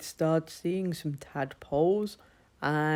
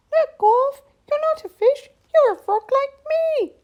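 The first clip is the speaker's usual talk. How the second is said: very high, louder squeaky frog; frog friend voice; 11 dB louder than usual. Acted. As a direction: announce it gleefully like a tiny frog. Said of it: "said Gorf's new friend".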